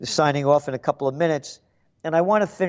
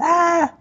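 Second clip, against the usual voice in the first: startled shriek; 14 semitones higher, 4 dB louder than usual. Retold or imitated. Mother at window shrieks.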